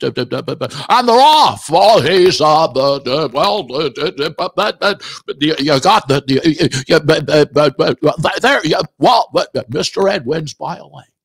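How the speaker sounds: stammering horse race caller, building up; Jimmy Stewart race caller. Retold or imitated. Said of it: "Jimmy Stewart is a horse race announcer".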